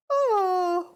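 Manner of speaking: drawn-out yawned oh; sleepy mother yawning mid-story. A narrator's reading. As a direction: let a yawn carry the word. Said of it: "with a yawn".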